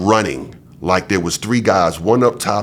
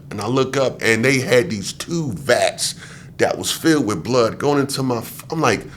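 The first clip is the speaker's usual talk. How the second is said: ordinary talk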